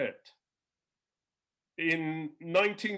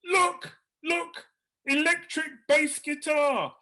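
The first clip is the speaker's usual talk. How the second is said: very high, louder delight; thrilled old man; 9 semitones higher, 4 dB louder, slower than usual. Retold or imitated. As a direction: point it out gleefully. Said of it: "Percy Granger goes".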